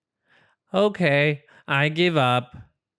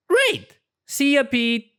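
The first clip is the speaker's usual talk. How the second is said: much higher, gleeful goodbye; delighted; 11 semitones higher than usual. Acted. Rabbit happily sending the porcupine to Mars, gleeful. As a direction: cheer as he leaves.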